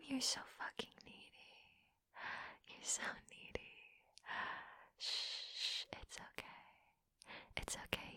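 very soft, tender reassurance; soothing whisper. Acted. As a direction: hush him gently, coaxing. Soothing, it's okay.

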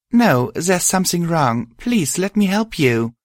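high, urgent plea to help; pleading. A narrator's reading. Narrator begging a crying friend to accept help, pleading. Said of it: "I pleaded".

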